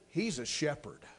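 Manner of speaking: soft, low-voiced reply of a simple believer; a village woman answering the missionary's wife softly; quiet old woman's answer. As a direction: say it gently and low, with quiet conviction.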